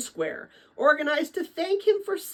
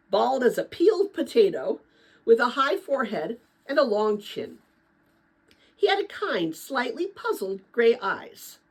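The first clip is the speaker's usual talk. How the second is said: usual speaking voice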